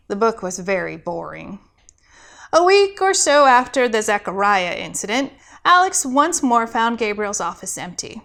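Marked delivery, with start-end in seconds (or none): none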